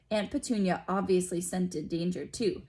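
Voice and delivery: ordinary delivery, nothing marked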